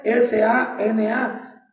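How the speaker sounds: "S A N A" spelled slowly, one letter at a time. Shown as a demonstration. Preacher spelling the Hebrew word to the church, slow letter by letter. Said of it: "una T".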